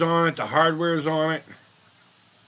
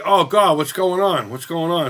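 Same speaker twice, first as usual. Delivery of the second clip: a louder, slightly raised "what's going on"; mock alarm; 3 semitones higher, 6 dB louder than usual. Streamer retelling his alarm at the news, mock alarmed. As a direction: play up your own confusion. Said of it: "And I'm like".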